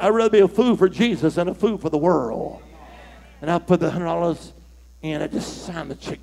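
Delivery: normal talking voice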